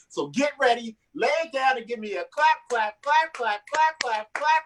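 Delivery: rising chant of clap clap, high; hype intro. Real, not acted. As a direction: whip the crowd into clapping. Host introducing next comic.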